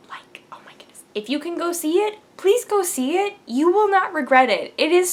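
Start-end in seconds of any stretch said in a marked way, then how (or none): 0.1-1.0 breathless whispered awe; awed whisper. Real, not acted. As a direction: whisper it as if too good to say aloud. Gushing about a movie.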